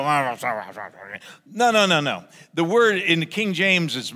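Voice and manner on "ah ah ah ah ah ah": mock grumbling groan. Shown as a demonstration. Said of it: "When you grumble, go".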